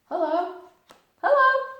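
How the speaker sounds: very high, loud bouncy hello; squeaky bright Tigger greeting. Acted. Tigger answering Pooh's hello outside the door.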